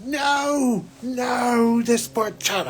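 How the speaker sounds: high anguished cry of no, fading; a high shocked no, easing into pity; upset cry. Retold or imitated. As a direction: cry out in dismay, then soften. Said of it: "And I'm just like".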